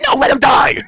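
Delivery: loud, high yell; mock heartless shout. Wife refusing help for choking husband, loud high shout. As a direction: shout it down in acted scorn.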